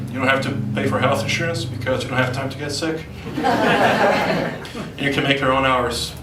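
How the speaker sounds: ordinary talk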